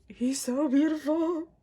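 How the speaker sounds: high, loud, drawn-out gush; adoring wail. Real, not acted. Fan admiring the photo card, adoring wail. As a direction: stretch it out in rapture.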